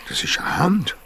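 breathless whispered realization; shocked whisper. Acted. Boy to friend, whispering on finding a hand. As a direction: whisper it in horror.

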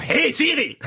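sharp high call out; loud call. Pretend. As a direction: bark the wake word. Calling the assistant bluntly.

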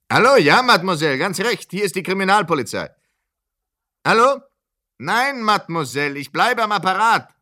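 high and loud over a crackling line; shouting into the phone. Acted. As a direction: make yourself heard on a bad phone line. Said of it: "Ich schreie laut?".

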